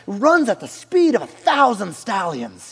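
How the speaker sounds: loud, high, slow mock-regal marvelling; hammed-up king with an unplaceable accent; old Persian king. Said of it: "like, an old Persian king showed up".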